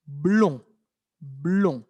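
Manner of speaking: "blond" ending on a nasal vowel, D mute; silent final D. Shown as a demonstration. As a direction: repeat "Blond" for learners with no D heard. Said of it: "Vimos que la D es muda".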